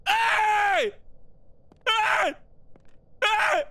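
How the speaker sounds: very high, loud victory yell; high celebratory hollering; triumphant shout. Real, not acted. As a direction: cheer at full volume, drawing it out. Celebrating beating the game.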